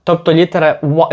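ordinary delivery

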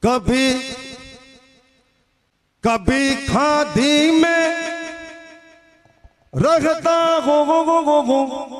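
mock echo effect, words repeated; loud, drawn-out chant with echo; cave echo. Shown as a demonstration. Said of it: "थोड़ा इको खोल दे". (गूंजती हुई आवाज़)

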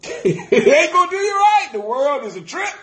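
high-pitched, laughing exclamation; laughing preaching. Real, not acted. Preacher scoffs at trusting the world, high laughing delivery. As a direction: crack up while driving the point home.

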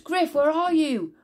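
high, loud, slow call. A narrator's reading. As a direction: call out for the cat. Moll calls for missing cat.

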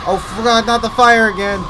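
loud, high panicked cry; oh no, not again shout; panicked yell. Real, not acted. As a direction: yell it in real dismay. Panicked reaction to enemy fire.

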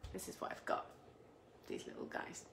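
whisper. Real, not acted. Showing her pasta shells to viewers.

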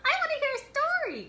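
very high squeaky puppet voice. Acted. Librarian voicing puppet Pongo for kids, high and squeaky. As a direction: chirp it like an excited puppet.

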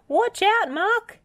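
very high, loud warning yell. Acted. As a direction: shout the warning fast. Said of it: "he yelled".

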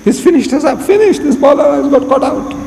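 high-pitched acted alarm; dismayed crowd. People seeing their Maulana caught out, dismayed. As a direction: voice the audience's reaction, pitched right up.

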